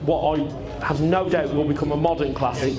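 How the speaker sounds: usual speaking voice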